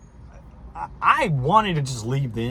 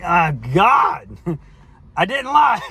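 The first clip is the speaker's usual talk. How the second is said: higher and louder, fed up; exasperated denial; 4 semitones higher, 6 dB louder, slower than usual. Retold or imitated. To his girlfriend who keeps pushing, an exasperated laughing denial. Denying the lie again, exasperated.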